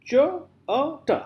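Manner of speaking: "ch, uh, t" as separate sounds with small gaps; split into sounds. Shown as a demonstration. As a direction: sound out "ch, uh, t" one phoneme at a time. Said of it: "How many, uh, uh, sounds".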